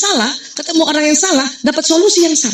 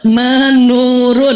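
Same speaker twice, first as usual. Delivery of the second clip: Menurun drawn out very slowly, each syllable weighed; stressing the word; 4 dB louder, much slower than usual. Shown as a demonstration. Doctor to mothers, stressing the word slowly. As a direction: lean on Menurun slowly to mark the difference. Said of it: "Beda menurun dengan berkurang".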